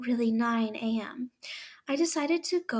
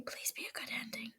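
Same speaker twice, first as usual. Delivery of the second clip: much softer, fast whispered wish; fast faint plea; 12 dB quieter, faster than usual. Real, not acted. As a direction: rush it under your breath. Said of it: "hopeful plea repeated".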